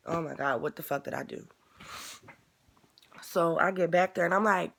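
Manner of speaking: ordinary talk, nothing marked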